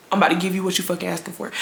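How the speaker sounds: low-volume, quick threat; muttered warning. Retold or imitated. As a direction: mutter it fast, about to blow. Muttered warning before the outburst.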